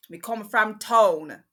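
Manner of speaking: loud, drawn-out Jamaican patois; mock-proud Jamaican holler; Jamaican accent. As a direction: belt it out slowly in a playful Jamaican accent. Playful burst of Jamaican speech.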